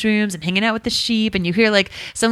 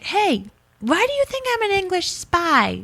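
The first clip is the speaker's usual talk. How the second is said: mock British accent; 10 semitones higher than usual. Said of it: "and she's like".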